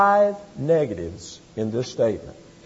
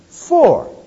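For said alone, loud and higher; stressed word; 8 semitones higher, 5 dB louder than usual. Shown as a demonstration. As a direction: land hard on the single word For. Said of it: "It's the word for".

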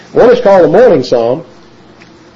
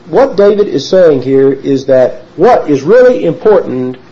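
ordinary talk, nothing marked

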